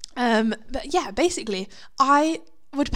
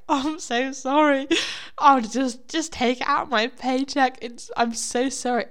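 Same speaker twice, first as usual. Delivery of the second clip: higher-pitched mock grovelling; flustered apology; 3 semitones higher than usual. Worker to manager over old notes, mock-flustered apology. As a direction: re-enact an over-apologetic plea.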